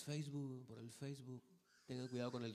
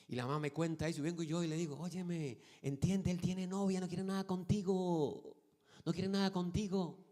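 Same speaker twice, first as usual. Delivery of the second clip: louder, higher preaching voice; rising sermon intensity; 8 semitones higher, 8 dB louder than usual. Acted. Pastor telling the girl firmly he has a girlfriend. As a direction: press the point firmly.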